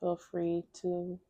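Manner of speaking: soft, unhurried meditation voice with long pauses; guided meditation. Real, not acted. Therapist guiding viewers to release tension, soft meditation voice. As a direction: guide them gently through a body scan, softly.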